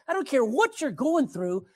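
normal talking voice

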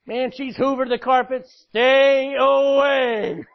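laughing, drawn-out stay away; mock fearful guest. Pretend guest laughing at the spotless floor. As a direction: ham up a scared guest.

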